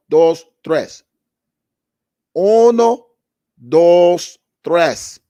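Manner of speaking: shouted uno, dos, tres, pauses between; loud Spanish count. Real, not acted. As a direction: yell out each number like a chant. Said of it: "I'll say it again".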